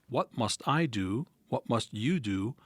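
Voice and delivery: usual speaking voice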